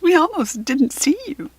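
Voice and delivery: put-on eager girl amazed at the fairy; excited. A narrator's reading. Rachel greeting the hidden fairy, excited. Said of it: "said Rachel in an excited voice".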